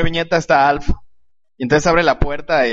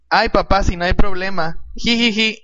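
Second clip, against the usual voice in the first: higher, girlish cartoon daughter with a titter; mock-coy higher voice; 5 semitones higher than usual. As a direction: lightly play the daughter reassuring dad, then giggle. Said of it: "la hija le dice".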